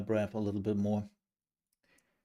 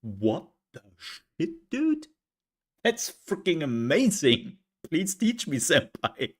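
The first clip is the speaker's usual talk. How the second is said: mock-amazed fanboy, pitched up; hammed-up excited disbelief; awed fan; 5 semitones higher than usual. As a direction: gush like a starstruck student to a senpai. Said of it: "It's like".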